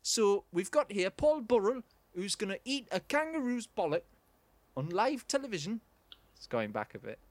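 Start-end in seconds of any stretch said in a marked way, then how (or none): 0.0-5.8 chirpy Geordie TV presenter; Dec. Acted. Player trying his half of a presenting duo, chirpy Geordie. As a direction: introduce the act in a light Geordie lilt. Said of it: "I'm trying to do Dec".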